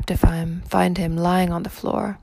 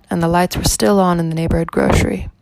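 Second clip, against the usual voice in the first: ordinary delivery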